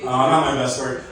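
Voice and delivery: ordinary talk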